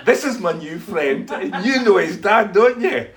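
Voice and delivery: slow, loud, high hint to back off; mock-threatening introduction, retold for laughs; pointed warning. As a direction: drop a heavy hint, half laughing. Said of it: "In other words, lay off".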